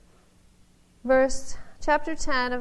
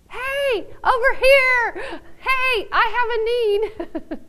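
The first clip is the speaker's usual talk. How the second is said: loud, much higher shouts to get noticed; shouting out; 13 semitones higher, 9 dB louder, slower than usual. Pretend. Preacher acts the blind beggar shouting over the crowd, loud. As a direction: yell over the crowd to be heard. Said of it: "saying it really, really loud".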